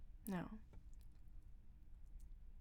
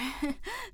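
light giggle; 9 semitones higher, 6 dB louder than usual. Acted. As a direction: giggle playfully. Laughs during scene.

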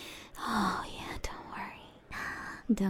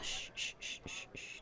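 soft, hushed shh sounds; barely audible calming shush; soothing shushing; 13 dB quieter, faster than usual. Acted. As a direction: hush them gently. Soothing listener in roleplay.

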